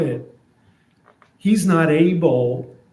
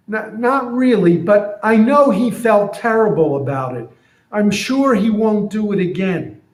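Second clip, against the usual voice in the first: higher, hurried loyal donkey; donkey defending the one who beat it; excusing donkey; 6 semitones higher than usual. Retold or imitated. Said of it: "So here's what I'm imagining".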